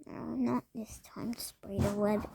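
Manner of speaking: whispered under the breath. Acted. Character mutters while wiping web.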